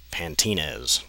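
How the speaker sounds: normal talking voice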